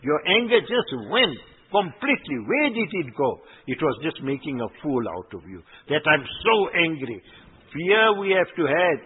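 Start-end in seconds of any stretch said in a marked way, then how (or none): none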